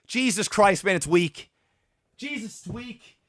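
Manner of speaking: low, dropping away to very soft; low, disappointed grumble fading out; deflated disgust. Real, not acted. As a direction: let the anger drain into weary dismay. Disgusted verdict after the rant.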